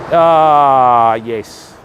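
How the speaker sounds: ordinary talk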